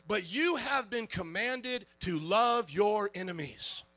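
ordinary talk

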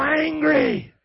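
loud, high mock-angry shout; hammed-up roar of the word; Doctor Angry. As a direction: lead the crowd in a mock-furious yell. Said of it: "You got Doctor Angry".

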